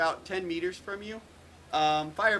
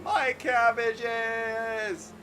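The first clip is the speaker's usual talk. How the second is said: long, raised cry of outrage; yelling; 7 semitones higher, 5 dB louder, much slower than usual. Acted. Game master as dude heard from the car, drawn-out yell. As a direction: yell it slowly, dragging the last word out. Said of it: "this dude yelling".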